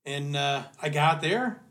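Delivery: usual speaking voice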